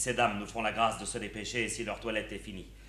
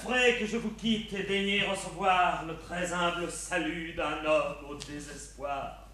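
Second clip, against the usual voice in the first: hammy stage actor; 6 semitones higher than usual. Acted. Mock despairing farewell.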